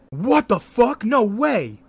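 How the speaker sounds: high, loud disbelief; shocked. Real, not acted. Reading breaking NBA news to his co-host, stunned and loud. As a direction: blurt it out in real shock.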